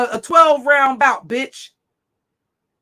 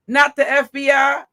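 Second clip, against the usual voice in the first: ordinary talk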